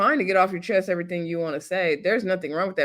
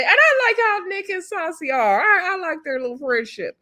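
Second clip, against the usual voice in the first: much higher, delighted gush; light up over their friendship; 10 semitones higher than usual. Real, not acted. Gushes about the friendship.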